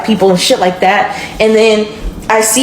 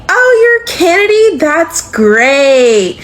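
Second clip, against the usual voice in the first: much higher, sugary mock welcome; fake-sweet mother; 7 semitones higher, much slower than usual. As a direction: play the mother greeting her with hidden intent. Boyfriend's mother voiced, fake welcome.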